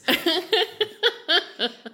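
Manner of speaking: big high laugh; high burst of laughter. Real, not acted.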